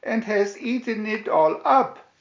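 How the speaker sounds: put-on Baby Bear complaining in a wee voice. A narrator's reading. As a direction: squeak it out like a tiny bear cub. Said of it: "Baby Bear in his wee voice".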